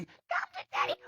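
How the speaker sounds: tiny, very high soft squeak. Retold or imitated. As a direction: imitate a creepy little voice, squeaky and hushed.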